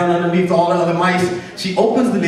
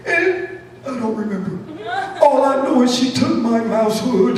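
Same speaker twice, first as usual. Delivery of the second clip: put-on high old-mouse voice; old Mouse Johnson; 10 semitones higher than usual. Old mouse running out of memories, high comic lament. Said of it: "Like old Mouse Johnson".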